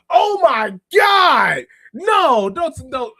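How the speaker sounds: high, loud, slow shocked yell; real shocked outcry. Real, not acted. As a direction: cry out in genuine shock at the message. Reacting to shocking chat message.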